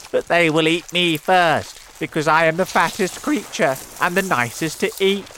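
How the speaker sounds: loud, high, panicky wail; fearful cry. A narrator's reading. Earthworm sure he is first to be eaten, fearful cry. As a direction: wail it, speeding up towards the end. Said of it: "cried the earthworm".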